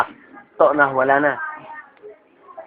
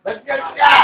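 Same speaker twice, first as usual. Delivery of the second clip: shouted repeat; 9 semitones higher than usual. Real, not acted. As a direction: shout the question, higher.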